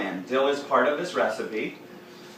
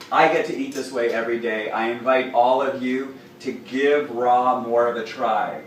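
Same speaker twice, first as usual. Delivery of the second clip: normal talking voice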